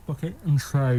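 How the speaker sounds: talking with fingers in mouth. Shown as a demonstration. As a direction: say it with fingers stretching the lips. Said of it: "put your fingers like that and just say bucket and spade".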